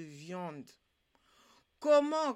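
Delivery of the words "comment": verontwaardigd uitroepen. A narrator's reading. Kat ontdekt lege pot.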